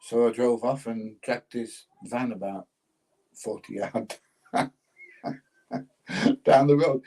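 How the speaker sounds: ordinary delivery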